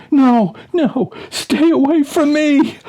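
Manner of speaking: high, loud panicked scream; terrified scream. A narrator's reading. Archaeologist screaming at the mummy, high panicked shriek. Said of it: "the archaeologist is screaming".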